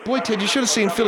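normal talking voice

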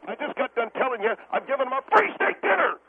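high-pitched, exasperated rant; emotional outburst, pitch soaring by the end; worked-up outburst. Acted. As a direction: lose control and protest, voice climbing high. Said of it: "whether he is emotionally capable".

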